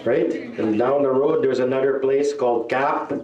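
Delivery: ordinary delivery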